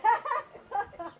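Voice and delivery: laughing through the effort; breathless laugh into the count. Real, not acted. Laughing mid-exercise while counting.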